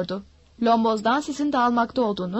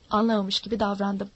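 ordinary delivery, nothing marked

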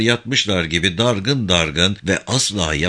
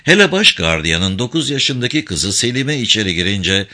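plain reading voice

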